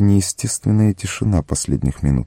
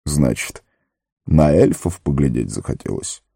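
measured wizard with softened threat; mock-menacing; 4 semitones lower than usual. A narrator's reading. Gandalf questions Sam about elves, mock-stern. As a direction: question him sternly, without real menace. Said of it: "Грозно, но уже не страшно спросил он".

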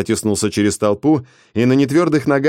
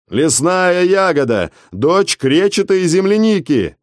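ceremonial call; 8 semitones higher, 3 dB louder, slower than usual. Acted. Вызов на церемонии.

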